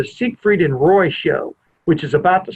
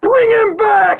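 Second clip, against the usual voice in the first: high-pitched mock yell; retold scream; slower than usual. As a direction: imitate a man screaming out, pitch way up. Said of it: "He starts screaming".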